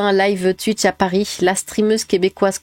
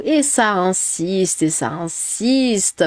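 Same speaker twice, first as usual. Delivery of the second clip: slow emphatic; much slower than usual. Real, not acted.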